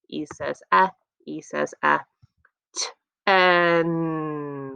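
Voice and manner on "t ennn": "T. N." as t, then a drawn-out en; segmented word. Shown as a demonstration. Teacher asking for the final sound, segmented word. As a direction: split ten after "T", holding the "N". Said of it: "What's that final sound you hear?".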